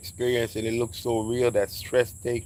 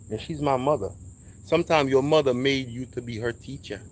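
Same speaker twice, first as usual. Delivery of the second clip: ordinary talk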